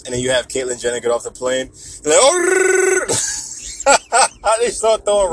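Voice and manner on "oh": high trilling yell; long high-pitched "oh" noise; mock crowd outcry. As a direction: imitate an alarmed crowd with a long shrill cry. Imitates crowd reacting to Caitlyn.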